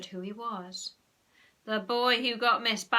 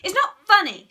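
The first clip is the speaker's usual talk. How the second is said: high, loud snap; sharp, offended outburst; indignant snap; 11 semitones higher, 15 dB louder than usual. A narrator's reading. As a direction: snap back crossly. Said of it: "snapped Margaret".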